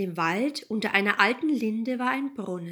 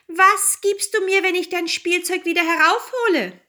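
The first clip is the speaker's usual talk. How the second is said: high, loud frog character voice; calling frog; 9 semitones higher, 6 dB louder than usual. A narrator's reading. Frog calls to the weeping princess, high and loud.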